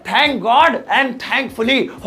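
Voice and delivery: very slow, spaced-out English words; slow model phrase. Shown as a demonstration. Teacher introducing two expressions of relief, very slow and measured. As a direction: draw the phrases out for learners.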